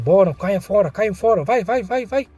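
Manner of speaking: high, loud thug yelling to clear the room; shouting them out. Acted. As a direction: bark them out of the funeral hall. Thugs chasing mourners out.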